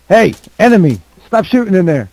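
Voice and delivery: loud, high yell; shouting. Acted. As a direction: yell it across the room. Enemy role calling out.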